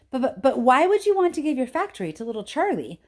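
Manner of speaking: put-on high, flustered old man; stammering grandpa. A narrator's reading. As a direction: sputter it out in amazement.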